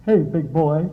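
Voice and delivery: low, teasing come-on; mock flirty line. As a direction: lightly play the flirt for a laugh. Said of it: "I don't want you to get that expressive".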